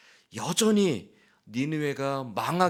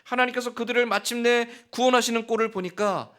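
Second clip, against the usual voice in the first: preaching climax; 10 semitones higher, 5 dB louder than usual. Real, not acted. Preacher building passionate climax.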